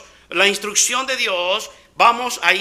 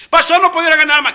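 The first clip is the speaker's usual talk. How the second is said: fast, high whining Moses; whiny excuse; 6 semitones higher, 6 dB louder, much faster than usual. Acted. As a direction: plead off the job in a hurry. Moisés voiced with excuses. (Moisés quejumbroso y rápido)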